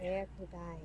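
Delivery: murmured echo. Retold or imitated. Echoing the Inigo line.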